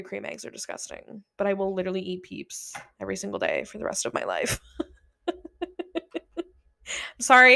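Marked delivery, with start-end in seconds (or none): 4.6-7.5 apologizing through a laugh. Real, not acted. Admitting her Peeps love to listeners, laughing. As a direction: laugh out the sorry.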